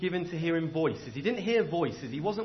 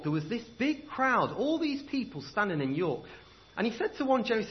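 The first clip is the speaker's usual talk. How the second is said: ordinary talk, nothing marked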